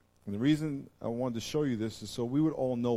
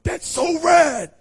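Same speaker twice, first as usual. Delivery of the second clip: high, loud, stretched-out shout of delight; excited shout; 13 dB louder, much slower than usual. Retold or imitated. Preacher imitating the waiter's thrilled shout. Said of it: "And he went".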